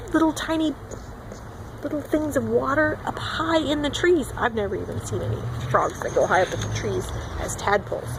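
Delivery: ordinary talk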